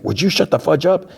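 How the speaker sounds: high-pitched exasperated demand; mock irritation, voice pitched high. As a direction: snap at a chattering companion, pitch up. Irritated man silences his companion.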